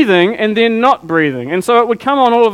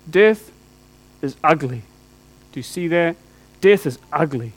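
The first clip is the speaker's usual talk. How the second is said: low hushed grief; 4 semitones lower, 4 dB quieter than usual. Real, not acted. Grieving father on death.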